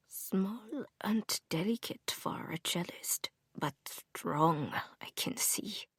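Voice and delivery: put-on an old man's hushed German accent, whispered. A narrator's reading. Old bowmaker inspecting Grace's hand, whispered German accent. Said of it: "in a thick German accent".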